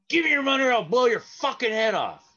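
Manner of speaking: retold gunman's loud threat; threatening yell. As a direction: bark the threat to scare him. Robber threatens to shoot.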